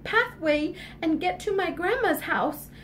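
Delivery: put-on high, sweet obedient girl; a good little girl promising mama. Little Red's voice.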